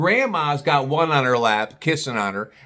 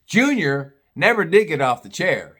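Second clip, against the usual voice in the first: ordinary talk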